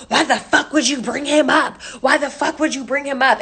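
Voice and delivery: accusing, hammered-out imitation; retold angry confrontation. Retold friend angrily confronting Cass.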